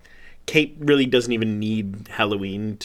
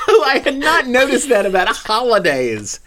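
sarcastic laughing; 12 semitones higher, 6 dB louder than usual. Real, not acted.

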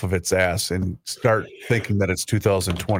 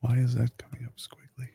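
whispering to self; 6 dB quieter than usual. Real, not acted. Muttering at the screen.

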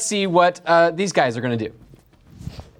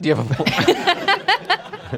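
laughing; 11 semitones higher, much slower than usual. Real, not acted. Repeating the question, cracking up.

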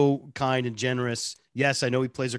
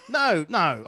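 higher-pitched mock English accent; 4 semitones higher than usual. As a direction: protest in a put-on English accent. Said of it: "it started in England as a bar bet".

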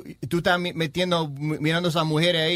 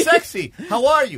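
mock flirty text; 6 semitones higher, 6 dB louder, slower than usual. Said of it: "Y la tipa le".

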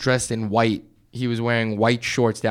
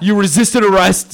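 yelling cop; 6 semitones higher, 6 dB louder than usual. Retold or imitated. Cop line retold.

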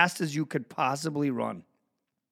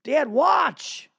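put-on kid begging parents to watch; 6 semitones higher, much slower than usual. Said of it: "All they want you to do is watch".

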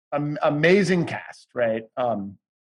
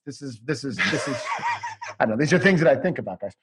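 normal talking voice

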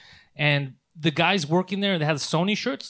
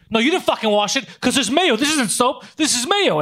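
higher, imitating an irate customer; mock angry yell; 7 semitones higher than usual. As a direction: act out the furious guest's outburst. Retold mayo argument with waiter.

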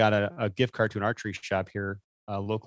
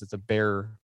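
ordinary talk, nothing marked

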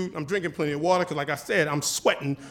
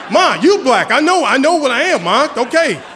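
laughing exasperation; 4 semitones higher, 8 dB louder than usual. Retold or imitated.

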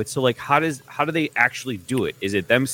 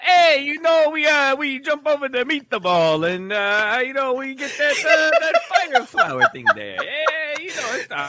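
high, laughing Irish gangster voice; a hammed-up Irish mobster talking, much higher; Irish mobster accent. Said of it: "I don't know why I went Irish there".

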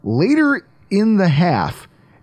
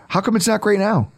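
ordinary delivery

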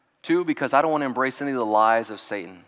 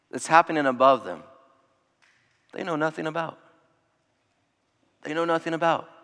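normal talking voice

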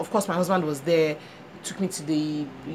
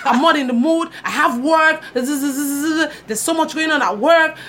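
higher, louder mock husband refusing; irritated husband; 6 semitones higher, 4 dB louder than usual. Husband refusing to help, mock irritated and loud. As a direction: play him snapping he's got work, pitched up.

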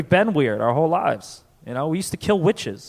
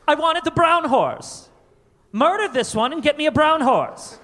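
bratty heir's tantrum, pitched way up; rich kid voice; 10 semitones higher than usual. Acted. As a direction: insist like a pampered child in a high voice.

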